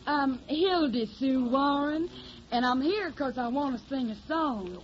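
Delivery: put-on slow, drawling Tennessee country girl, Southern accent; put-on hillbilly accent. Hildy poses as a Tennessee country girl, in a put-on Southern accent. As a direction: play the naive Southern hillbilly singer. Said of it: "I got the technique down pat".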